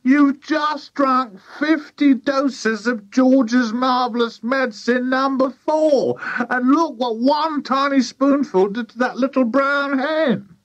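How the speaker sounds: high, slow, horrified wail; shrieky, drawn-out character alarm; wailing Missus Cranky. A narrator's reading. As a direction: wail every word in high-pitched dismay. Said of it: "wailed Missus Cranky".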